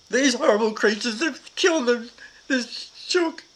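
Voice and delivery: put-on child sobbing over the dead hen; retold weeping. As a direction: cry it out like a heartbroken child. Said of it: "And I was weeping".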